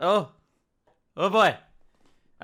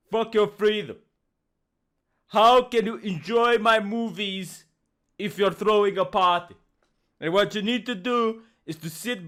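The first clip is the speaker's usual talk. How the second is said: badly faked Austrian accent; deliberate, commanding mock Austrian accent; Arnold Schwarzenegger. Film star ordering people to watch his movies, mock Austrian accent. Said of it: "I can't do his accent".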